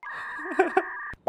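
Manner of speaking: giddy shrill laugh; high squealing laugh. Real, not acted. Laughing while cooking.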